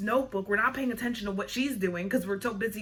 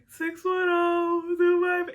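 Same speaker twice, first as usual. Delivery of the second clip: high, drawn-out wail through the digits; nervous kid's exaggerated sobbing recital; mock crying; 8 semitones higher, 5 dB louder, much slower than usual. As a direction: blubber the phone number, stretching every syllable. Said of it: "I'm, like, crying 'cause I'm, like, nervous".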